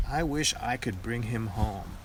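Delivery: ordinary delivery, nothing marked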